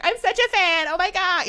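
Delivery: high, loud fangirl squeal; uncool gushing fan. Retold or imitated. Hostess meeting her comedy idol, uncool gushing. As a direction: gush with no chill at all. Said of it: "very uncool".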